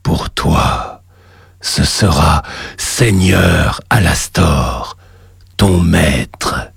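deep, slow, echoing demon god; cavernous voice. Acted. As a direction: boom it up from the lava below. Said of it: "une voix caverneuse".

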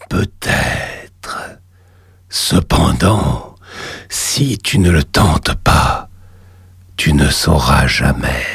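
deep, slow, hushed cavernous voice; underworld god. Acted. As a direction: tempt him from the depths. The lava god voice.

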